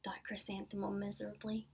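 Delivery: plain reading voice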